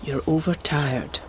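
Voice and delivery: a soft, tender whisper. Acted. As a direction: hush him gently, under your breath. Tender reproach in the garden.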